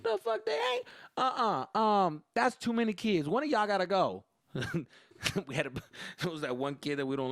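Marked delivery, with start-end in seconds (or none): 0.0-4.2 high, loud mock-indignant mom; objecting mom. Mom refuses her son's friends, high and loud. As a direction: put your foot down as an annoyed mom.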